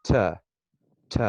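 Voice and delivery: ordinary delivery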